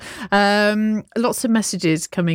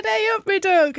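mock [name] twang; 12 semitones higher than usual. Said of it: "[name] said".

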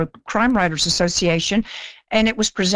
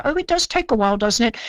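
put-on posh British accent; 3 semitones higher, faster than usual. Princess Margaret quoted again.

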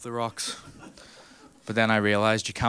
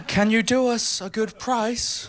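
slow, much higher posh English toff; 12 semitones higher, slower than usual. Retold or imitated. English visitors asking Belfast shipbuilders, mock posh English. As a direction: drawl it like a pompous English gent. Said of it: "English people ... were like".

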